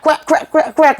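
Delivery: high sharp crack crack sounds, spat out; quick string of high cracking noises; imitated neck cracks. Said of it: "She went like this".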